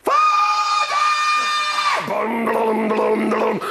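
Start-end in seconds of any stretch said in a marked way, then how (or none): 0.0-2.1 veel hoger en luider, uitgerekt naar boven; wanhopige kreet. Acted. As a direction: roep je vader uit alle macht. Kind roept naar de hemel. (kind roept wanhopig naar zijn vader in de hemel)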